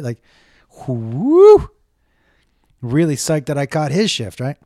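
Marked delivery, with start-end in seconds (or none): 0.9-1.7 a high, loud whoop of relief; mock whoo cheer. Comic celebrating he got the skilled doctor, mock whoop. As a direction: whoop like you won a prize.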